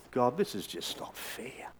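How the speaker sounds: soft, slow broken plea; grieving plea to God. Retold or imitated. Grandfather telling God after losing grandson, quiet grief. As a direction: barely voice it through grief. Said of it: "I can remember saying".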